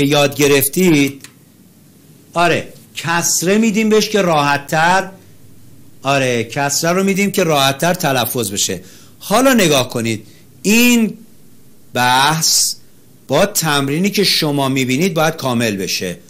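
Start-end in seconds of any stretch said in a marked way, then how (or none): none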